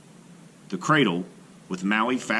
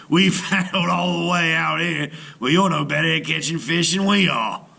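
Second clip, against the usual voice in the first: loud, higher scornful growl; 7 semitones higher, 4 dB louder than usual. A narrator's reading. Brother jeers at Maui's failed fishing, loud growl. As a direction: jeer at Maui loudly. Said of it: "one growled".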